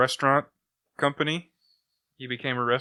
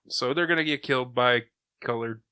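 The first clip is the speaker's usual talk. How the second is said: normal talking voice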